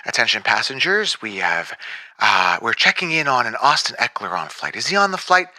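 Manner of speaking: low, deep captain announcing to cabin; airline pilot voice. Acted. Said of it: "like the sound effect".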